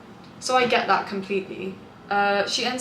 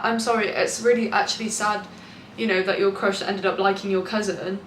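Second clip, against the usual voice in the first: normal talking voice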